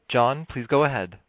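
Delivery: usual speaking voice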